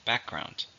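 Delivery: Background with the stress on back, said quietly and clearly; stress on first syllable. Shown as a demonstration. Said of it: "Two, stresses on the first".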